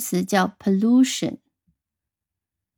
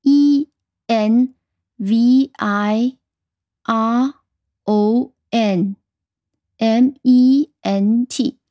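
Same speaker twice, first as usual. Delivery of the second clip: E N V I R O N M E N T spelled letter by letter, slowing at the end; teacher spelling the word for the class; much slower than usual. Shown as a demonstration. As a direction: spell it one letter at a time. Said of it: "那么拼写呢".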